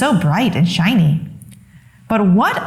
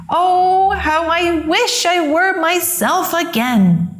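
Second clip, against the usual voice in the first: put-on much higher, pleading young donkey; longing wish; 9 semitones higher, slower than usual. A narrator's reading. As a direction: ache to be yourself again. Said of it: "he thought".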